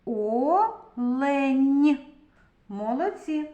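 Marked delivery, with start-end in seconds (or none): 0.0-2.0 Олень read slowly as two held syllables, О-лень. Shown as a demonstration. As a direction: split Олень after the О, louder and drawn out. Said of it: "Прочитаємо".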